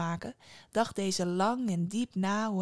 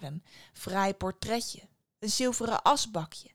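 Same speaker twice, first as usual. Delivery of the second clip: ordinary delivery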